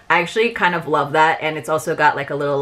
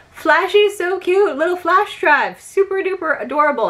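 cutesy gushing; 10 semitones higher than usual. Real, not acted. Vlogger fawning over the cute robot drive, cutesy gushing. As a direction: gush over it like a tiny pet, pitched way up.

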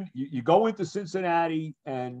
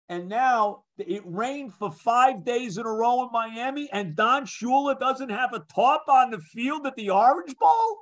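incredulous; 7 semitones higher, slower than usual. Real, not acted. Interviewer ribbing Miami's coach over the uncovered field, incredulous. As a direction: build to an astonished, high-pitched question.

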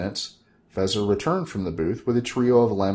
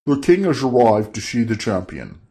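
a lightly voiced herald bursting in; Gobblesniff's voice; 5 dB louder than usual. Gobblesniff bursting through the wall, lightly voiced herald. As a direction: announce the king with a touch of a louder herald.

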